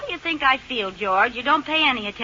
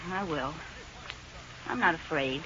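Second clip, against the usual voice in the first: nervous quiet; 7 semitones lower, 14 dB quieter than usual. Acted. Wife nervously resolves to face maid.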